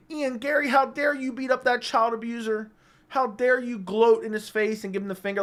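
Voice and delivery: high, whiny mock-offended fan; mock outrage. Mocking MMA fans in a high whiny voice. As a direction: imitate a pearl-clutching fan. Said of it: "they're like".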